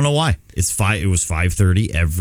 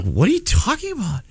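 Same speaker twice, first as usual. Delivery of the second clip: high alarmed question; alarmed disbelief; 12 semitones higher than usual. Retold or imitated. Father asks his son what he means, in alarm. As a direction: ask it like the floor dropped away. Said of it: "you just get this panic".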